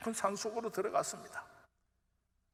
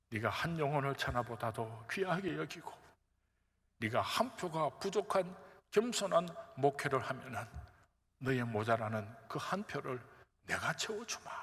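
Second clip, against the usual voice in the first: softer, lower, tender voice of God; hushed, gentle promise from God; God's tender promise; 4 semitones lower, 4 dB quieter than usual. Retold or imitated. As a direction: speak it softly as a loving father's promise.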